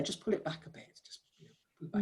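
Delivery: whispered note to a colleague; hushed feedback. Pretend. Listener quietly advising a describer during practice, whispering. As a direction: drop to a near-silent whisper, as a discreet prompt.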